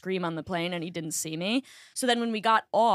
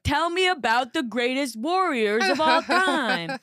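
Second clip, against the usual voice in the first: condescending indulgence; 4 semitones higher, slower than usual. Retold or imitated. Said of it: "treating me like I was a fucking kid".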